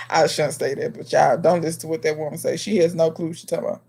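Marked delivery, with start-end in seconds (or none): none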